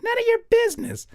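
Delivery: put-on very high, loud snippy kid; bratty child. Daughter snapping at dad about her drawing, loud high kid voice. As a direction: sass her dad like a little girl. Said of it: "She's like".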